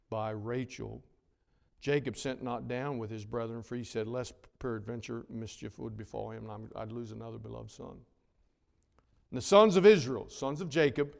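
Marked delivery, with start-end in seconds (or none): 4.1-8.0 worried father. Acted. Jacob fears losing Benjamin.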